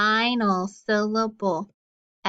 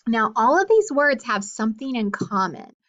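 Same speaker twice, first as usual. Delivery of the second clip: ordinary talk, nothing marked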